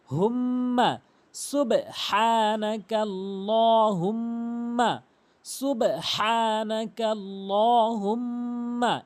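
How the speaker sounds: very slow recitation with long vowels and held humming m; correct slow recitation. Shown as a demonstration. Teacher modelling for learners, slow elongated recitation. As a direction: draw it out melodically, holding the ghunnah. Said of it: "এভাবে উচ্চারণ করবেন".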